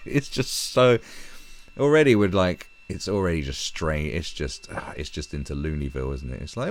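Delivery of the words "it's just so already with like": normal talking voice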